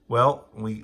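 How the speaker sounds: ordinary talk, nothing marked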